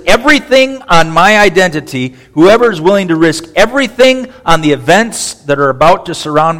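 booming word from the pulpit; authoritative proclamation. Shown as a demonstration. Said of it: "I'm proclaiming this authoritatively".

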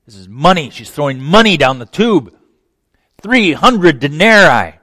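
seething, swelling to a loud complaint; seething resentment. Acted. Judas complaining to Jesus about the ointment, seething. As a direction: let the resentment boil over. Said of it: "You can just hear the seething".

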